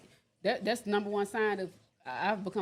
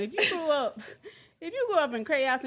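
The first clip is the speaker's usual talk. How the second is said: talking through laughter, pitched up; high, loud start broken by laughs; laughing; 6 semitones higher, 4 dB louder than usual. Real, not acted. Laughs while starting her point.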